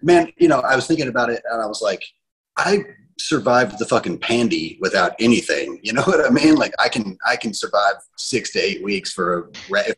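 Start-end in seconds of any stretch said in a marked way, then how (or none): none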